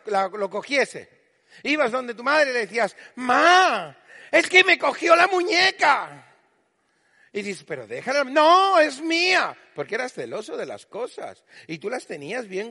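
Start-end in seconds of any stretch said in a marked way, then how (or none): 3.2-6.4 aguda y fuerte, llorona; quéjate a mamá como una niña pequeña; niña quejica. Retold or imitated. Niña quejándose a su madre. 7.6-9.6 "no" agudo y fuerte, posesivo; niño posesivo. Retold or imitated. Niño defendiendo su juguete.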